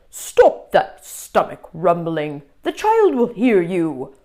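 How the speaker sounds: stern monster hushing the class; scolding monster. Acted. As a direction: scold them from under the bed. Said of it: "a voice under the bed scolded".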